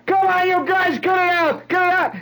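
high, loud panicked yell; high-pitched mock hollering; retold yelling. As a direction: yell it like you're being held down and struggling. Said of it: "he's yelling".

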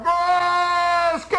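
high, sharp cry of gas; hollered warning. Retold or imitated. Soldier on the march alerting the column with a high yell. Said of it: "a guy will holler".

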